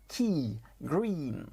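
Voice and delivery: "Key" and "green" with the I held long; long stretched vowel. Shown as a demonstration. Teacher telling learners to make it last, stretched "Key" and "green". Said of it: "qu'il va falloir tirer dessus".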